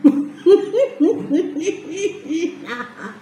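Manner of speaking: breathy delighted laugh; giggling laugh. Real, not acted. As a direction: laugh it off happily. Laughs after tasting.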